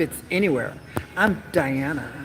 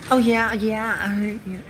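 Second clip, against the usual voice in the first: higher put-on voice; 5 semitones higher, slower than usual. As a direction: act the girlfriend trying too hard.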